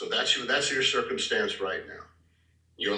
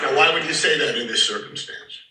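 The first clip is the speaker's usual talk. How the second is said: ordinary delivery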